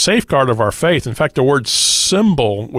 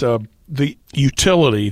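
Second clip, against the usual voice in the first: ordinary talk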